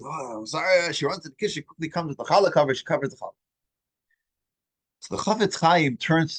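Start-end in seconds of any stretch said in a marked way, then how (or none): none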